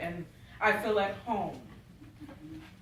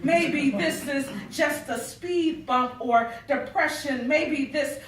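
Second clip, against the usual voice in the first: driving, rhythmic poetry delivery, louder and higher; spoken-word piece swelling toward its last line; spoken-word poem; 5 semitones higher, 8 dB louder than usual. Acted. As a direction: build intensity line by line as a performed poem. Continues reciting her poem.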